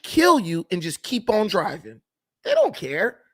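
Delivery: ordinary talk, nothing marked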